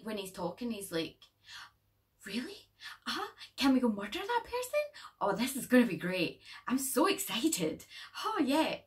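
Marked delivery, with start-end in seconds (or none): 2.2-8.8 high, gushing mock excitement; eager kid. Vlogger mocking the eager boy in the movie, high and excited. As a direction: play the overeager little boy bouncing with glee. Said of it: "He's like an eager beaver".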